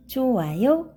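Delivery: plain reading voice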